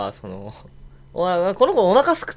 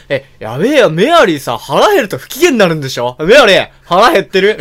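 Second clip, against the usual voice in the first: louder, slightly higher eager question; 4 semitones higher, 11 dB louder than usual. Retold or imitated. Noble loudly asks Mary in Japanese if she is hungry. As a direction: call out the question with eager concern. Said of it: "みたいな".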